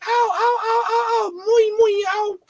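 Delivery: hammed-up squealed cries of pain; mock pained yelps. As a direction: yelp in exaggerated pain like a hurt kid. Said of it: "Misa gonna be like".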